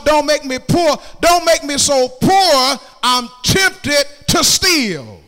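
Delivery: loud, high, drawn-out preacher's cry; full-force preaching. Acted. Preacher crying out a prayer against riches and poverty, full-force preaching. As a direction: preach it as an impassioned plea to the Lord.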